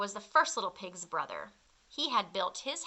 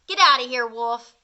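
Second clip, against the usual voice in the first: rude shout; 10 dB louder than usual. Acted. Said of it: "that rude little porker answered".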